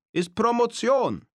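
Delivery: übertriebener osteuropäischer Akzent, hoch und langsam gerufen; osteuropäischen Akzent dick auftragen beim Werfen. Retold or imitated. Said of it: "mit seinem besten osteuropäischen Akzent". (Erli äfft mit osteuropäischem Akzent den Mafia-Boss nach)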